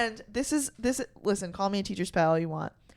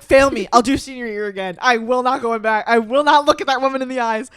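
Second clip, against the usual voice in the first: defiant declaration; 3 semitones higher, 10 dB louder than usual. Retold or imitated. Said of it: "I was like".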